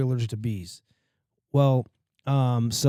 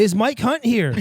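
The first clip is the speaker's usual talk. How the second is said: high, loud innocent mom voice; sweetly asking the whole room; imitating mom paging a customer; mom on the phone; 9 semitones higher, 5 dB louder, slower than usual. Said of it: "She's like".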